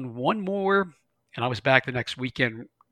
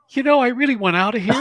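much higher, louder, fast near-shout; panicky inner voice; 10 semitones higher, 8 dB louder, faster than usual. Retold or imitated. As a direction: blurt it like a scared kid wanting out. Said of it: "the little voice inside of me is going".

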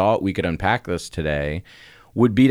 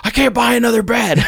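high, loud mock yell at the kids; retold yell; 6 dB louder than usual. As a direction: yell it at kids bouncing on the bed. Kids jumping on the bed.

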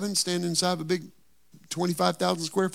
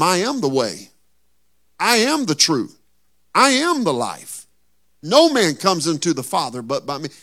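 ringing, preached proclamation; Jesus' words called out to the church; loud declaration; 7 dB louder than usual. Acted.

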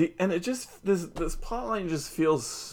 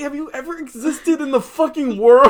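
high, laughing outrage at a character; cracking up while ranting at J T; laughing disbelief; 12 semitones higher, 4 dB louder than usual. Real, not acted. Laughing at J T's cluelessness, high and incredulous. Exasperated rant at J T.

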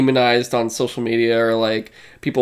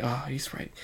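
very soft, sobered realisation; mock solemn reaction; serious hush; 10 dB quieter than usual. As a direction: drop to a near-whisper. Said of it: "it went from".

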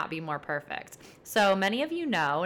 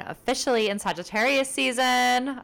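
big booming announcer shout, higher and louder; Oprah Winfrey; 8 semitones higher, 5 dB louder than usual. Acted. Podcast host announces Sagittarius season to listeners, hammed-up Oprah impression. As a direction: draw it out loud and high like a talk-show reveal. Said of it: "that was my best Oprah voice".

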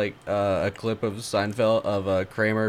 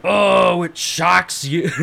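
Kramer voice; 5 semitones higher, 4 dB louder, much slower than usual. Pretend. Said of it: "come on, Kramer".

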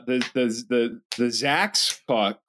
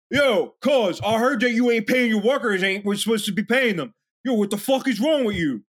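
higher, mock-indignant street guy; tough guy confronting; 8 semitones higher than usual. Guys in jorts to boss about unpaid workers, mock indignant. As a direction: confront them like a neighborhood enforcer.

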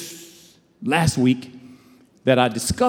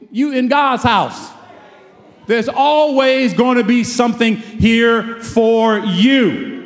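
preaching; 6 semitones higher, slower than usual. Acted. Exhorting the congregation.

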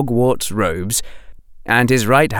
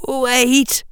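put-on high, thin, wavering boy's voice; a scared boy calling out from hiding; frightened young boy. A narrator's reading. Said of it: "high and thin and wavering".

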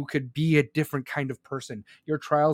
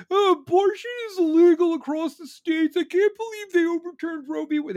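high, loud whiny imitation of protesters; mock their panic in a much higher voice; mock outrage; 11 semitones higher, 5 dB louder than usual.

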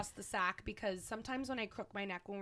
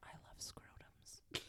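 slow, hushed whisper; hushed and drawn out; whispered aside; 19 dB quieter, slower than usual. Real, not acted. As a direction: whisper it slowly, like a confession. Murmured aside about a word.

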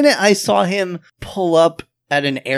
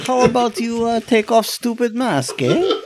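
put-on higher-pitched, slow Russian accent; nonchalant Russian reporter; 7 semitones higher than usual. Russian reporter coaxing Batman to unmask, nonchalant Russian accent. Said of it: "it was so nonchalant".

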